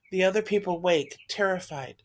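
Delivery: usual speaking voice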